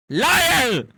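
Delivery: mock accusing shout. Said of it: "echoing the clip".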